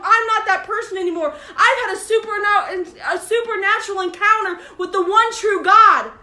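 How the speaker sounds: high, loud, joyful proclaiming; excited testimony. Pretend. Woman telling the village, bursting with joy. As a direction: cry it out like a newly saved woman.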